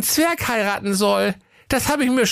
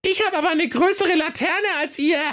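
much higher, called-out line; calling out; 8 semitones higher than usual. A narrator's reading. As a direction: call out over the others. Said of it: "Der Helga rief".

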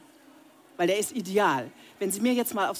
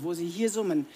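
normal talking voice

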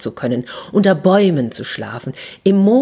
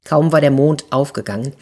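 ordinary delivery

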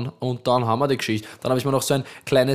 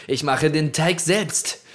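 recipe announcer voice. Acted. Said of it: "So mache ich ... die Rezeptankündigungen".

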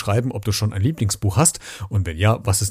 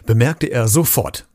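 plain reading voice

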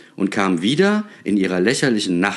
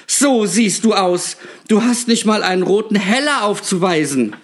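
scornful retort; 12 semitones higher, 4 dB louder than usual. A narrator's reading. Scornful shopkeeper mocks Nikolaus.

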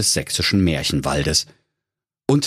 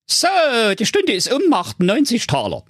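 annoyed owl doctor. A narrator's reading. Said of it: "verdrehte genervt die Augen".

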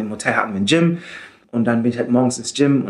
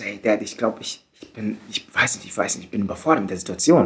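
low, hushed, hesitant confession; subdued retold phone voice, stumbling softly over the words; holding voice steady; 5 semitones lower, 5 dB quieter than usual. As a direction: imitate admitting it quietly, keeping the voice controlled. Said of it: "meine Stimme natürlich so gerade wie möglich zu halten".

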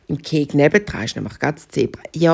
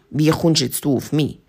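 ordinary delivery

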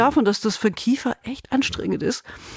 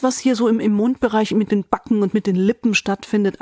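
normal talking voice

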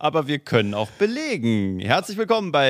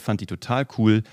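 usual speaking voice

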